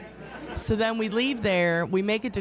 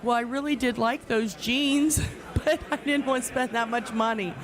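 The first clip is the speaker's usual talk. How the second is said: sheepish plea; 5 semitones higher than usual. Retold or imitated. Retold pleading to husband.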